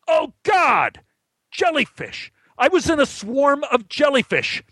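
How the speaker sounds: high-pitched horrified cry; acted panic. Swimmer realising he is in a jellyfish swarm, panicked high cry. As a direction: relive the horror in a high, strained voice.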